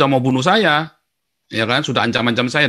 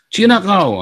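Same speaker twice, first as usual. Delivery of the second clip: imitated taunt; 8 semitones higher, slower than usual. Retells the same taunt at school.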